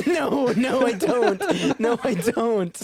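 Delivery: laughing, high and loud. Real, not acted. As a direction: giggle through the denial. Laughing off the accent request.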